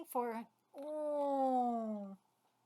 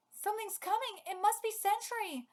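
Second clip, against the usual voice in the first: alarmed character; 8 semitones higher than usual. Acted. Character's alarm read aloud.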